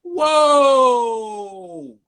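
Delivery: long, high, loud whoa; inner alarm. Retold or imitated. Driver reliving his shock at a traffic stop, alarmed. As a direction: draw it out in high-pitched alarm. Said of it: "in my head, I'm like".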